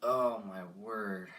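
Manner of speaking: usual speaking voice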